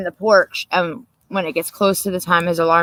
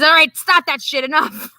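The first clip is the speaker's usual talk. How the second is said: mock annoyed husband; 7 semitones higher, 4 dB louder than usual.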